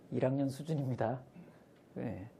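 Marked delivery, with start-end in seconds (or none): none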